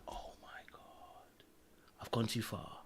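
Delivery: breathless realisation, barely voiced; shocked whisper. Catching himself after embarrassing her: shocked whisper. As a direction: whisper it to yourself, aghast. Realising he went too far.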